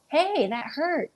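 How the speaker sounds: high, slow scolding of a dog; real, high-pitched "hey" of complaint; pained protest. Real, not acted. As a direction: object to being hurt by the dog. Dog hurts speaker during tussle.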